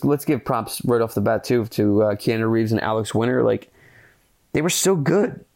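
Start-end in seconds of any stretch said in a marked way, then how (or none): 4.5-5.4 much higher, rising on so; bright rise, lifted high; excited praise. Real, not acted. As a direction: gush over it, pitch climbing on so. Praising the lead actors.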